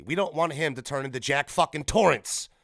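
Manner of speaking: ordinary delivery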